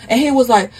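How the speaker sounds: ordinary delivery, nothing marked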